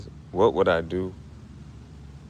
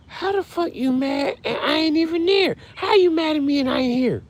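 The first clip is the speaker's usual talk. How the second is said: very high squeaky dog voice; voicing the dog. Grieving owner voices his dog scolding him. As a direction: squeak it out like a pet talking back. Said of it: "imagined dog's reply".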